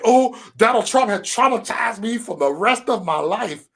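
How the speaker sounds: mock a traumatized coworker, drawn out; mocking coworker's complaint. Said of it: "I hear motherfuckers at work like".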